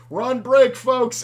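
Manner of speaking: high, loud imitated announcement; calling out. Tournament director announces a break to the players, mock shout. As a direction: holler it to the whole room.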